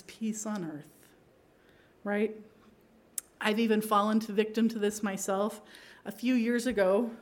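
normal talking voice

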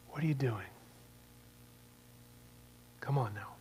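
soft, hushed, kindly rebuke; gentle quiet Jesus. Acted. Jesus to a humbled sinner, quiet and kind.

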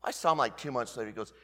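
usual speaking voice

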